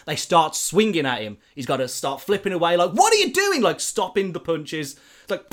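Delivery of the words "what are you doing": much higher, louder alarmed yelp; panicked cry. Retold or imitated. Peter dodging punches from the patients, panicked. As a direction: panic as the blows come.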